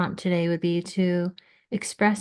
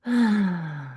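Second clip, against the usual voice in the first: long exhaled "ah" falling in pitch; drawn-out falling sigh. Real, not acted. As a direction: let out a long sigh after reading. Sighs after finishing the poem.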